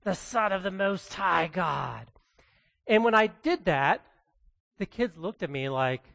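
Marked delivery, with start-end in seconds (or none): 0.0-2.1 soft, hissing demon voice, much quieter; hissy demonic voice. Pretend. Preacher reading the demon's plea to his congregation, in a soft hissing demonic voice. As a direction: hiss it out like a creepy demon. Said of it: "you know, hissy".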